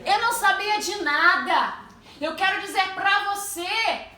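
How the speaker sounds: loud, high sermon delivery; fervent preaching. Acted. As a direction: preach it out with force. Preaches at full force.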